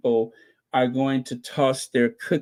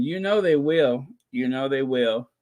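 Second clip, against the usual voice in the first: usual speaking voice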